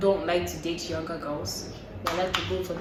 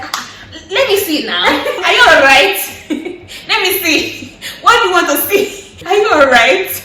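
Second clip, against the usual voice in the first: high, loud, laughing imitation of the caller; laughing mock caller demanding to see her; mock pushy caller; 10 semitones higher, 13 dB louder than usual. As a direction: ham up a pushy guy on the phone. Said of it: "he's like".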